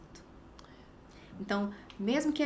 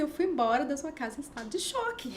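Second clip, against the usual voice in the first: higher-pitched comic dismay; retold shock; 5 semitones higher than usual. Friend telling young mother how she left, mock shock. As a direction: imitate a friend's aghast confession.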